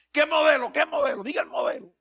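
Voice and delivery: high, pushy questioning; mocking preacher. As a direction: press for the model like a showman. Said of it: "Pero ellos dicen así". (predicador burlón y agudo)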